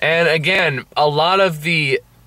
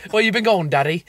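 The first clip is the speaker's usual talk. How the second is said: higher-pitched mock Cockney child; Cockney accent; 6 semitones higher than usual. Host mocks heroine asking after father, Cockney accent. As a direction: put on a playful Cockney accent. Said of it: "I'm doing a Cockney accent".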